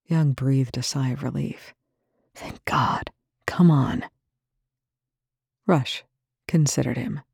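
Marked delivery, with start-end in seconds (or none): 2.4-4.1 relieved whisper. Acted. Relieved rescuer in the dark.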